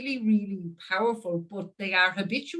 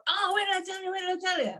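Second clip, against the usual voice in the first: much higher, bursting with eagerness; excited interrupting; 10 semitones higher, faster than usual. Retold or imitated. Interrupting a friend mid-chat, much higher and eager. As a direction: jump in like you can't hold the idea back. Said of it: "being so excited".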